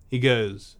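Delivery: ordinary delivery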